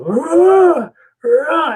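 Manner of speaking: high, loud imitated animal scream; imitated elk scream. Telling friends about the scream from the woods, high loud imitated cry. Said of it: "you could hear this loud scream".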